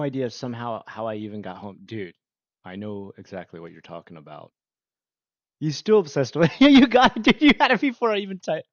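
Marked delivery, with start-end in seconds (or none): 5.6-8.7 high, loud, laughing burst; excited shout. Real, not acted. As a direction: blurt it out, thrilled the chat got it. Chat guesses answer, streamer reacts.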